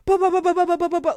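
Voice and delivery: loud, high rapid-fire ba ba ba; spray it like machine-gun fire; mock gunfire burst; imitated gunfire. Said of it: "it sounds like".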